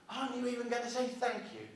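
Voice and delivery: high, soft-voiced mock indignation; indignant retort. Customer to silent checkout woman, mildly flipped indignation. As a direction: retell a small flip-out, pitched well up. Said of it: "I flipped a little bit".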